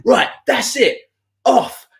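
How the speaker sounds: high, slow, final order; mock stern dismissal. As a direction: throw them out as the fed-up groom. Groom throwing band out.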